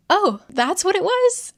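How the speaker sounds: high, incredulous mock gasp of a question; mock surprise. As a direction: play it as mock disbelief. [name] reacting in disbelief.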